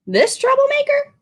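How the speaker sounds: quick, high disbelief; high scoffing question. Acted. Shopkeeper scoffing at the boy's choice, high and quick. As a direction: scoff at the very idea.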